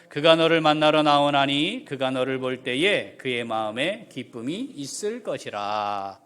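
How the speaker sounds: loud, measured Bible reading; scripture reading. Acted. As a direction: read the verse solemnly to the church. Reads scripture aloud.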